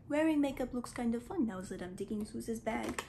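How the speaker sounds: ordinary delivery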